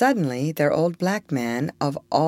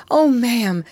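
higher, louder desperate appeal; wild pleading; 7 semitones higher, 5 dB louder than usual. A narrator's reading. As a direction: appeal wildly to the lady. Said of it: "turning wildly to the lady".

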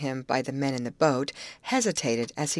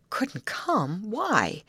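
worried echo, pitched up; note of fear; 5 semitones higher, much slower than usual. A narrator's reading. Betty hearing that Tom couldn't come, a note of fear. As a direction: question it with a touch of alarm. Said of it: "had a note of fear".

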